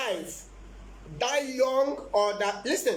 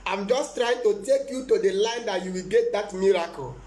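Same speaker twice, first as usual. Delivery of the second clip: usual speaking voice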